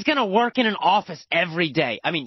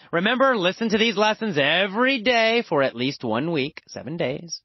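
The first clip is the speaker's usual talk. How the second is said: ordinary delivery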